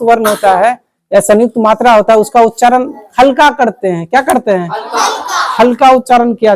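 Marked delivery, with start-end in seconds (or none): none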